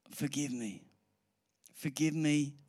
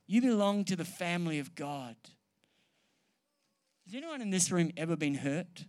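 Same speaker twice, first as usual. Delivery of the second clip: normal talking voice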